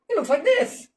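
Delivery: high, mocking pronouncement; mock pompous leader. World leader pompously describing God. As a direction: play a world leader sneering.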